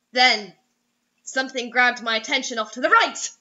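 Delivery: ordinary talk, nothing marked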